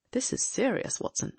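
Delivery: alert, intent gentleman detective; keen detective voice. Acted. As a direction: voice a sharp, watchful sleuth. Holmes voiced in a reading.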